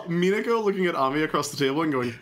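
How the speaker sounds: normal talking voice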